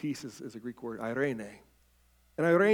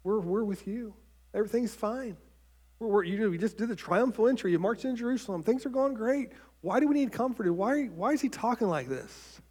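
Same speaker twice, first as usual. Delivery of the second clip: higher, excited and bewildered disciples; puzzled disciples; 7 semitones higher than usual. Acted. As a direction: play the confused followers who think all is fine. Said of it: "And they're like".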